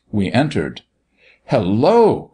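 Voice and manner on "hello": higher exclamation of surprise; startled cry. A narrator's reading. Doctor stopping short at the sight, startled cry. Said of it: "cried the doctor".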